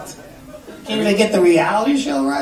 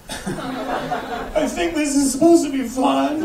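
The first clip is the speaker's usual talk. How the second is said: imitating a sad kid; 7 semitones higher, 3 dB quieter, slower than usual. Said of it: "the look on the kid's face like".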